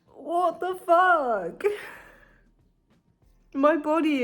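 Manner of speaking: high, long drawn-out bafflement; exasperated disbelief. Real, not acted. Baffled by worsening pain.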